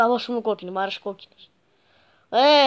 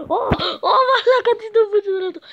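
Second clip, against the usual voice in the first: much higher, louder laughing exclaim; laughing outburst; 9 semitones higher, 5 dB louder, faster than usual. Real, not acted. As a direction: laugh in disbelief at the play. Laughing at game moment. (γελαστή έκπληξη)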